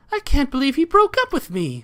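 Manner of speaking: high, loud whiny princess. Acted. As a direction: pout it like a heartbroken princess. Voicing princess line.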